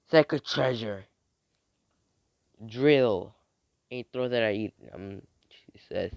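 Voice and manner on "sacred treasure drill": loud summoning call; acted call-out. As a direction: call the weapon's name out loud. Calling out a sacred treasure's name.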